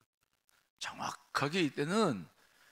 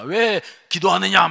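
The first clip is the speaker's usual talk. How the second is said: God's challenge; 6 semitones higher, 14 dB louder than usual. Acted. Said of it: "막 그러시는 거죠".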